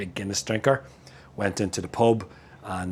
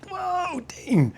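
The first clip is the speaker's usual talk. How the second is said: a high, exaggerated viewers' gasp of disbelief; mock viewer surprise; 4 dB louder, slower than usual. Imagined viewers teasing Dean over his beer, high mock surprise. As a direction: pitch it high like a shocked fan. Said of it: "you'll all be thinking".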